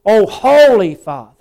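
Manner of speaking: high, loud plea to the Father; preacher's impassioned rendering of a prayer; passionate cry. Retold or imitated. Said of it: "Jesus is crying out with".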